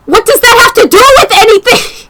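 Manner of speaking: real incredulous outburst; laughing outraged shout. Real, not acted. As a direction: yell in disbelief while laughing.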